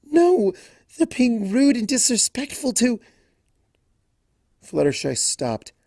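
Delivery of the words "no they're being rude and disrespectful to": high loud protest; upset objection trailing off. A narrator's reading. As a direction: object hotly. Angry protest cut short.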